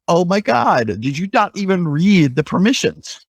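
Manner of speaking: high-pitched retold exasperated amazement; incredulous disbelief. Pen tester reacting to admins who granted full admin, incredulous. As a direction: pitch it up, aghast at their carelessness.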